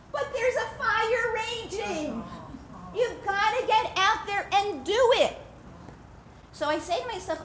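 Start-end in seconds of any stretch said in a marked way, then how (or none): 0.1-5.4 higher, slow urgent warning; urgent plea. Acted. Chafetz Chaim urging the young man, slow and urgent. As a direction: press it home, slowly.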